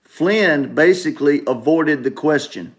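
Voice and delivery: normal talking voice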